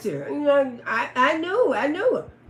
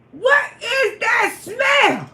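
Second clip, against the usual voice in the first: disgusted shout; 8 semitones higher, much slower than usual. Retold or imitated. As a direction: yell it out like something reeks. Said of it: "I say".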